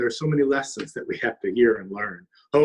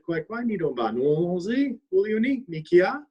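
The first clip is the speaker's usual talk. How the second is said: lightly voiced bird, higher and courteous; a touch of a gentle, higher bluebird; polite bluebird; 8 semitones higher than usual. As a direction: answer sweetly like a polite little bird. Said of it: "was polite and said".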